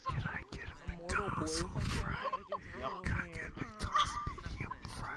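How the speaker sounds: high breathy whisper. Acted. Begging for fast food.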